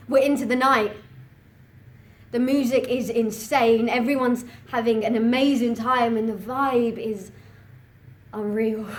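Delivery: loud, excited club-night report; thrilled. Acted. First-time clubber raving about the music and vibe, thrilled.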